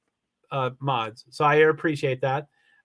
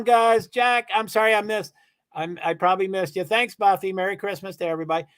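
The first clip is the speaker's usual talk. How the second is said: normal talking voice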